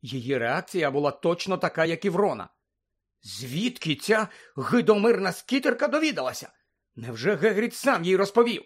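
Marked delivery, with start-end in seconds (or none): 3.2-8.6 high-pitched, outraged disbelief; raised pitch, astonished questioning; shocked indignation. Acted. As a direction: demand answers, scandalised. Shocked reaction to the article.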